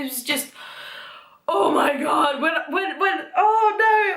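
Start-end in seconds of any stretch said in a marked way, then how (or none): 1.5-4.2 higher, stammering mock panic; dramatic distress. Fan freaking out over Umbridge, dramatic distress. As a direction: splutter it out, overcome with dismay.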